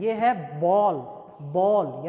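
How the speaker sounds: बॉल with a long open o; model pronunciation. Shown as a demonstration. Teacher to students, modelling the long o, clear demo. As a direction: stretch the o in बॉल. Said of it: "बड़े ओ की sound इसमें आ रही है".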